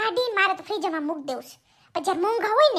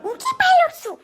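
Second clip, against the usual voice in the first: indignant daughter; 6 semitones higher than usual. Acted. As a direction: challenge mom, half offended, half teasing. Daughter confronts mother about call. (માને સવાલ કરતી નારાજ દીકરી, ઊંચા અવાજે)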